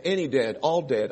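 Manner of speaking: ordinary talk, nothing marked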